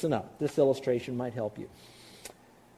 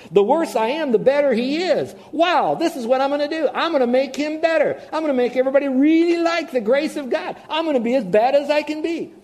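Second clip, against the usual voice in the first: much higher and louder, hammed-up glee; mock the foolish schemer with delight; giddy ridiculous plan; gleeful sinner; 10 semitones higher, 8 dB louder than usual. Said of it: "what they might be thinking is this".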